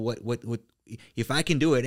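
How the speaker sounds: usual speaking voice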